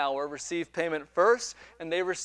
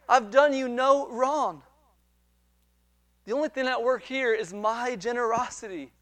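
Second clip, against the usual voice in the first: earnest reassurance; 6 semitones higher than usual. Acted. Master answers the grumbling laborer.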